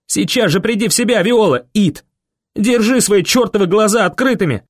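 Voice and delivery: high, forceful commanding yell. A narrator's reading. Boy right in Viola's face, yelling. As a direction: order her back to consciousness. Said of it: "заорал я ей прямо в лицо".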